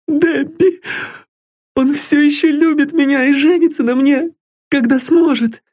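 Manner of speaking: put-on high, eager young girl pleading sweetly; a lovesick girl dreaming of a home; high girlish voice full of hope; young girl Sylvia. A narrator's reading.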